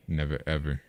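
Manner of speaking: ordinary talk, nothing marked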